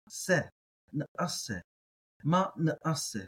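na, a, se with a guttural stop before a; ayin stop. Shown as a demonstration. Teacher sounding out the ayin, syllable by syllable. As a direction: break na and a with a glottal catch. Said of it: "That's how I'm gonna pronounce it".

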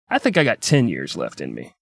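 a touch of low-voiced stoicism; understated low character quote; lightly voiced Kennedy. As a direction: drop the pitch for his reply. Kennedy's reply acted out.

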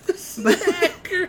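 high, stretched, laughing repeat; laughing echo. Real, not acted. Friend repeating the daughter's nickname through laughter. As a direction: laugh out the word.

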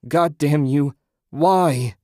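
loud, high, furious cry; grieving husband cursing whoever took his wife; anguished curse. A narrator's reading. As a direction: curse them through the grief.

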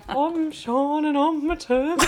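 high, drawn-out mock Brummy accent; slow, laughing parody of a Brummy; Brummy impression of co-host. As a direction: tease the host with a hammed-up Brummy voice. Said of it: "do an impression of your voice".

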